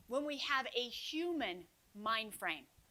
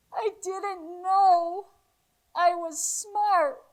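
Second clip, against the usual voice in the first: slow and louder, close to tears; overwhelmed confession; 4 semitones higher, 11 dB louder, much slower than usual. Retold or imitated. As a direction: let it out slowly, fighting tears. Student overwhelmed after hearing news.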